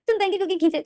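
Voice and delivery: put-on high, innocent whine of a hurt child; childlike hurt voice. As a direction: sound like a scolded little boy. Lenzen childlike, upset.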